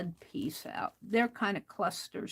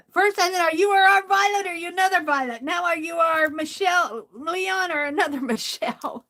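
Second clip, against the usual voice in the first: higher, louder words through real laughter; laughing while talking; 8 semitones higher, 8 dB louder than usual. Real, not acted. Crafter asking viewer which Michelle, laughing loudly. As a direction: push the words out between laughs. Laughing over name mix-up.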